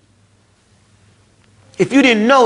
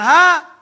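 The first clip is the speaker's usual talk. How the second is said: high, loud lost "ah"; helpless groan; 9 semitones higher, 5 dB louder than usual. Acted. Dead man in the grave, high helpless groan. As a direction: moan with no answer to give. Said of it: "There's the sound".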